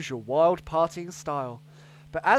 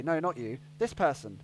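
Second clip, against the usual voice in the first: ordinary delivery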